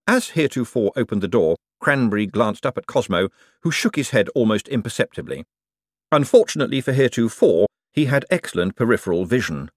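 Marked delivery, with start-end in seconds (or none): none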